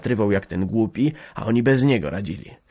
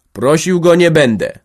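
sharp shout; 8 semitones higher, 9 dB louder than usual. A narrator's reading. Boryna refusing Rocho, calling out sharply. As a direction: cry it out sharply, high and loud. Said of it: "zawołał ostro".

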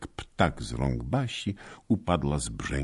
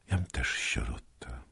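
sad whisper; 2 semitones lower, 6 dB quieter than usual. Acted. Sad confession, tear falling.

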